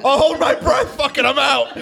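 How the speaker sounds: very high, squeezed voice through laughter; laughing bravado. Acted. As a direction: blurt it out while cracking up. Acts reckless dash, laughing.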